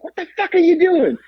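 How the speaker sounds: high-pitched mock outrage; acted incredulous scolding; exasperated retold demand. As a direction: imitate a friend in total disbelief. Exasperated friend confronts her, retold.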